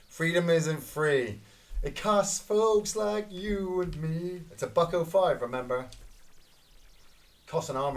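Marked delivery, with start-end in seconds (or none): 0.1-4.4 slow, grand mock-patriotic delivery; hammed-up patriotic line; mock anthem. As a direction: deliver it like a solemn slogan. Quoting a patriotic jingle.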